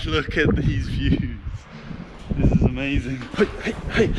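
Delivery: breathless and panting; gasping for air after the climb; out of breath. Real, not acted. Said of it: "Sorry, I'm so out of breath".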